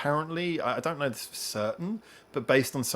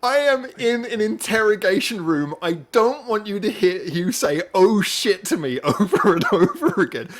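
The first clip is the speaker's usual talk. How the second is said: laughing exasperation; 7 semitones higher, 8 dB louder than usual. Retold or imitated. Speaker's exasperated inner protest.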